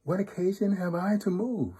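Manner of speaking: put-on high-pitched little-girl protest; innocent little daughter. A narrator's reading. Little daughter denying to the wolf that she moved, high innocent voice. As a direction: ask it innocently in a high child voice. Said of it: "little daughter tells him".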